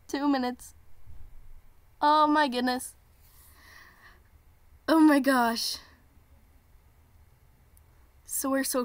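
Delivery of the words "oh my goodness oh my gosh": excited. Real, not acted. Excited by the viewer countdown.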